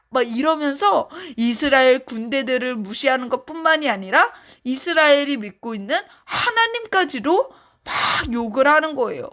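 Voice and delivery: ordinary delivery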